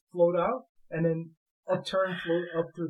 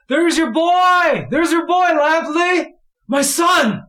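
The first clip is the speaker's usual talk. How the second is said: shouting; 11 semitones higher, 12 dB louder, much slower than usual. Acted. Fishermen calling out from shore.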